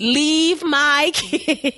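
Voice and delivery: each word drawn out very slowly; slow insistent repeat. Retold or imitated. Woman repeating her demand to the ex, very slowly. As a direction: drag it out like a broken record. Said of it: "you just keep saying the same thing".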